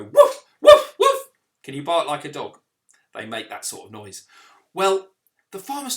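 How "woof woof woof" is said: put-on high, loud woof for the children; dog barking. Shown as a demonstration. Vicar showing children a dog noise, loud barking. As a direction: bark like a playful dog. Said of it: "They go".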